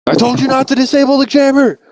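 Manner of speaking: high, sharp blaming voice; furious I-told-you-so; angry scout. Retold or imitated. Scout blaming partner for the jammer, angry. Scouts arguing mid-attack.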